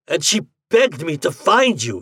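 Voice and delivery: high, loud impassioned roar. A narrator's reading. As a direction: roar it back at him. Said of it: "he roared".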